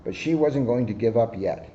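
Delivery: ordinary delivery, nothing marked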